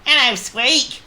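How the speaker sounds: high, loud little worm voice; worm character. A narrator's reading. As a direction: pipe up as a perky worm. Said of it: "the worm beside whose hell Squeak".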